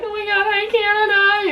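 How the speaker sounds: high-pitched, hammed-up excited hello; giddy greeting. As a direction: greet a long-missed love, very high. Retold giddy greeting at border.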